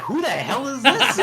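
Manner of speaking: high, loud mock bewilderment; baffled parents. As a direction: imitate parents baffled by a stranger on screen. Voicing parents' baffled reaction.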